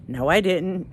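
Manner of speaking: ordinary delivery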